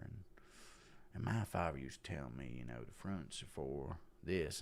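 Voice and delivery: low, soft Texan actor impression; musing softly with a Texas whistle in the voice; Matthew McConaughey. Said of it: "I've never tried a McConaughey impression".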